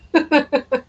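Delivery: high giggle at being a mess; laughing. Real, not acted. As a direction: burst out laughing at yourself. Laughs at own hot mess.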